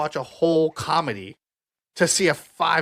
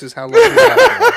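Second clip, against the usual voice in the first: words squeezed out through high laughter; can barely talk for laughing; 13 dB louder than usual. Real, not acted. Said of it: "we did not edit this laugh".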